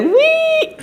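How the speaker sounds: very high playful "Wee!" squeal; a balloon of ego sliding down; silly high sound-effect voice; deflating squeal. Retold or imitated.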